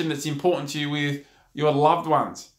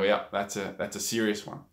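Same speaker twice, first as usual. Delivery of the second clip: low, softer, grave aside; grave reaction; 7 semitones lower, 6 dB quieter than usual. Retold or imitated. Onlooker reacting to a bad scream, grave and low. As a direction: say it low and quiet, sobered. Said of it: "And you go".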